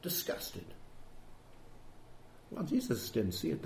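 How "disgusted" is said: scornful Pharisee. Retold or imitated. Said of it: "here's the Pharisee over here saying".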